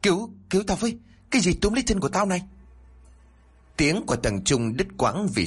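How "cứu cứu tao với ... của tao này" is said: very high, rushed panicked scream; screaming for help. A narrator's reading. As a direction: cry out as you are dragged under. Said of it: "không ngừng la hét". (la hét cầu cứu, cao vút và dồn dập)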